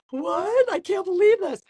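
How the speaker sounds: half-crying, overwhelmed with joy; re-living tears of joy at getting her blue belt; teary disbelief. Retold or imitated. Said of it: "I'm, like, bawling".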